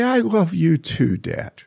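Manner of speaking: slow, high acted tenderness, falling away at the end; high, drawn-out retold reply; loving son. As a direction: answer dad with earnest affection. Retold son returns father's love.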